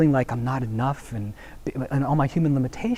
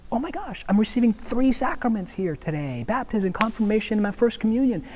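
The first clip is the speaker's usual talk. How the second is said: imitating her gushing, pitched higher; bubbling over about her sacraments, higher; retold joy of a baptism candidate, pitched up; excited convert; 7 semitones higher than usual. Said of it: "it was like".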